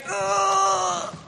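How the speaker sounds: strained grunt while lifting; carrying heavy load. Real, not acted. Lifting a big heavy gift.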